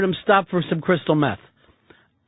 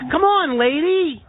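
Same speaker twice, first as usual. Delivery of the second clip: "Come on, lady" drawn out slowly and high in a Philly accent; 7 semitones higher, slower than usual. Shown as a demonstration. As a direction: say "lady" the Philadelphia way, high and slow. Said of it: "the way they say lady".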